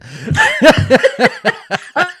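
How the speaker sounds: loud high cackle of laughter; burst of laughter. Real, not acted. Big laugh at the podcast guest.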